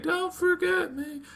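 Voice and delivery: very high, slow mock girl voice; drawn-out falsetto plea; pleading dream girl. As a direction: plead like a hammed-up anime girl. Retelling dream girl's plea.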